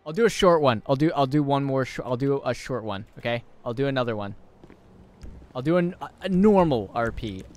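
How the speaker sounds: normal talking voice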